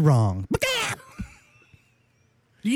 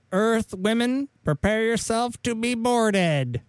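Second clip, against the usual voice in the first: alien invader voice; 7 semitones higher, slower than usual. Acted. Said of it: "The voice doesn't help".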